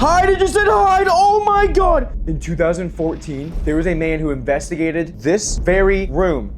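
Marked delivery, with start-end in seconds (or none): 0.0-2.3 high-pitched amazed exclamation; shocked outburst. Real, not acted. Ghost hunter reacting to the box saying hide, shocked outburst. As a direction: cry out in real astonishment. Startled by word from ghost device.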